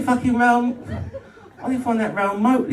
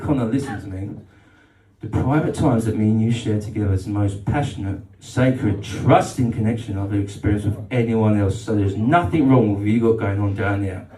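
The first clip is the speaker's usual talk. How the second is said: put-on tender girlfriend; 7 semitones lower than usual. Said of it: "She said".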